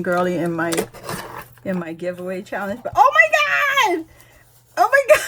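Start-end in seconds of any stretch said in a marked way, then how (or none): 3.0-5.2 high, loud squeal of shock; thrilled, high-pitched outburst; excited squeal. Real, not acted. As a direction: squeal in delighted disbelief. Delighted shock at gift.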